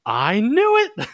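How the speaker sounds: high, slow and loud, a squealed "I knew it"; imitating her gleeful high squeal of realization; triumphant "I knew it". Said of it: "she's looks at me and she's like".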